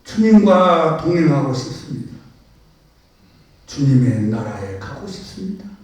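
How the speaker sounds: loud, fervent confession read as a prayer; impassioned prayer. Acted. Preacher reads an anonymous believer's confession, fervent and loud. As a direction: pour it out to God at full force.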